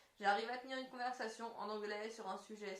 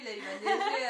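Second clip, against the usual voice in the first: laughing; 11 dB louder than usual. Real, not acted. Joking about snow when singing.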